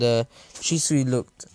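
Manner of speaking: ordinary delivery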